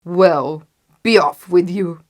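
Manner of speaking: loud troll dismissal; gruff troll. A narrator's reading. Troll letting the little goat go, loud and gruff. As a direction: send them away in a booming troll voice. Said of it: "said the troll".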